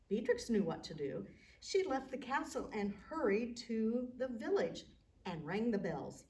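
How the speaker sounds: ordinary delivery, nothing marked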